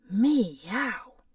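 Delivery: a loud rising cat meow. Acted. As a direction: yowl like a hungry cat. Cat-eyed doctor meows.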